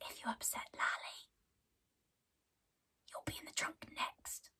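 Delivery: hushed menacing whisper; whispered threat. Retold or imitated. Husband whispering a threat to Selena, hushed. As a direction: breathe the threat right in her ear. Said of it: "Cade whispered".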